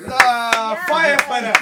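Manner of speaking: excited shout. Real, not acted. Hyping up a friend's outfit.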